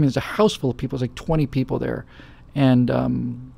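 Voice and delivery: ordinary delivery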